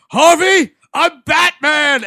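high, loud mock yell; imitated movie-hero shout; Val Kilmer. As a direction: holler it out over a crowd, stretched at the end. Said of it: "everyone's yelling. And, like, Val Kilmer's like".

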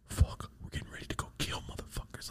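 very soft, breath-only whisper; inner-voice whisper. Retold or imitated. Viewer in his head during a war episode, whispering. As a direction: whisper it like a private thought. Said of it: "in my head, I'm like".

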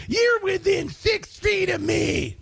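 high, slow mocking shout of a germaphobe. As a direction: shriek it like a panicked stranger, hammed up. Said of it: "This jackweed standing over".

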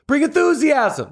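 high-pitched burst of enthusiasm; peppy, energetic exhortation; enthusiastic. Shown as a demonstration. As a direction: pitch it way up and rally them. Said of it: "That was pretty good".

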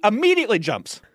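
normal talking voice

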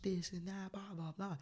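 squeaky mocking girl voice; mocking high voice. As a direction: mimic her high chatter.

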